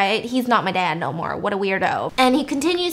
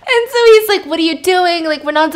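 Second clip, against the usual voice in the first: laughing through the retelling; giggly, high retelling; 8 semitones higher, 7 dB louder than usual. Real, not acted. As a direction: still giggling, higher and louder. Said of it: "laughing while retelling".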